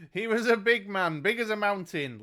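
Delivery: high, loud boasting; boastful storyteller. A narrator's reading. Mungo bragging about his father, boastful. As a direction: boom it out as a proud tale-teller. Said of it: "laughed Mungo".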